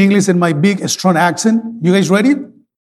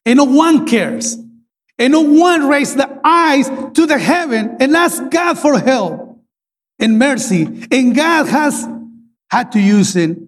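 higher, pleading sermon line; fervent preaching; 5 semitones higher than usual. Acted. Pastor translates his plea to the church, fervent preaching. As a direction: press the church to look up.

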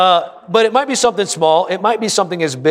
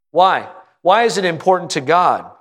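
usual speaking voice

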